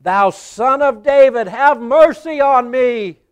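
high, loud, slow desperate plea. A narrator's reading. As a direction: shout it over the crowd. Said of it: "he cried so much the more".

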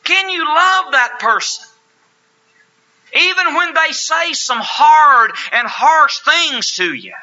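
loud, high, impassioned preaching. Acted. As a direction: preach it with full fire. Preaching at full force.

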